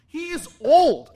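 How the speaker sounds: put-on high, loud, slow kid voice; son's complaint. Son complaining about the new player, high slow kid voice. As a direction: drag it out like a disgusted kid.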